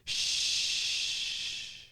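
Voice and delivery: long shush fading away. Shown as a demonstration. Everybody answering the flat tire cue, long shush. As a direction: let it hiss out like a tire. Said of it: "and everybody goes".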